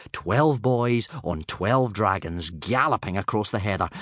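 ordinary delivery, nothing marked

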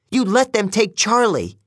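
high-pitched outraged accusation; upset accusation. A narrator's reading. Selena accuses Annabeth in anger.

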